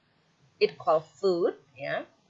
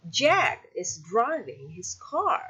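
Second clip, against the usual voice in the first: JACK, DRIVING and CAR accented, slowly; stressed content words; slower than usual. Shown as a demonstration. Teacher showing students which words are accented, stressed content words. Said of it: "Jack and driving and car is accented".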